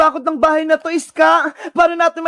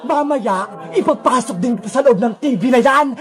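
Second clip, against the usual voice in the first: low, menacing ghost villain; Sadako villain voice; 5 semitones lower than usual. Acted. Sadako explaining to captive Princess, low and menacing. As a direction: confide a dark plan in a low villain voice.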